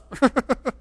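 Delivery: short high laugh. Real, not acted. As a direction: laugh at the joke.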